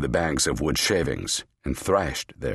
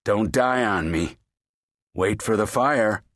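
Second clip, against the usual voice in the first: calling out; 6 semitones higher than usual. A narrator's reading. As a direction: call it down the stairs. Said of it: "Mooney called".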